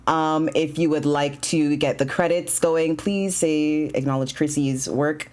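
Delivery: ordinary talk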